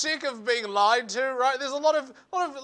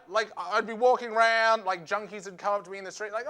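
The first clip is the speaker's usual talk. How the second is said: ordinary talk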